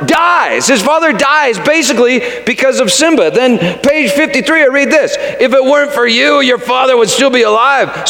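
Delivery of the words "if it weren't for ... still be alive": usual speaking voice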